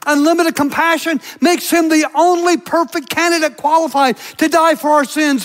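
normal talking voice

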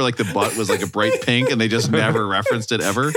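usual speaking voice

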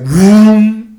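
long loud "vroom" like a train horn; imitated train horn. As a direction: blast out a drawn-out train horn noise. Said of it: "like, fucking loud".

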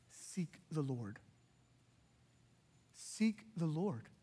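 soft, slow, low exhortation; slow down and press it home gently; earnest plea. Real, not acted. Preacher urging struggling listeners, soft and slow. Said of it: "listen here".

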